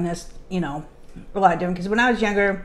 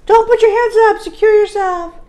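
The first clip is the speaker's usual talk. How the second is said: loud, high-pitched yell of warning; adult warning shout; 9 dB louder than usual. Acted. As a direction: holler it like an alarmed adult. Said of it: "as an adult, you're like".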